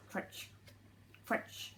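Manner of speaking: soft crunch noises; quiet crunching effect; crunching sound. Acted.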